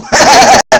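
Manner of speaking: loud burst of laughter. Real, not acted. Cosplayer laughing after faking death, loud burst of laughter.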